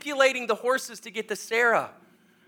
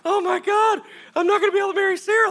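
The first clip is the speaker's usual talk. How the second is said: high, loud, rushed panic; mock freak-out; 12 semitones higher, 4 dB louder, faster than usual. Hired hand fears losing his marriage, high mock panic. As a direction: wail it fast in comic despair. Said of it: "so now I'm freaking out".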